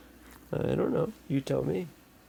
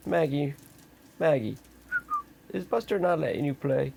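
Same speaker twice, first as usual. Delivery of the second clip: normal talking voice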